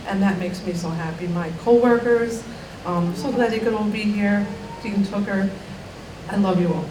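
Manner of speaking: fighting back tears as she thanks everyone; getting emotional. Real, not acted. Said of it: "I'm not gonna get emotional".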